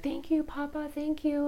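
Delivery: high, soft, tearful thanks; a grateful girl near tears; imitating tearful daughter. Daughter thanking grandpa, voice high and tearful. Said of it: "crying, and she's like".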